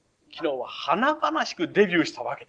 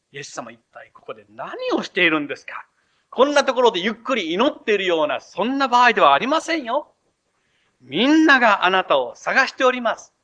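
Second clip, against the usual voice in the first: louder, higher, pushy reproach; impatient interruption; 5 semitones higher, 7 dB louder than usual. Retold or imitated. Disciples interrupting Jesus at prayer, impatient and loud. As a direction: barge in on someone praying. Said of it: "祈りを妨げるようにして".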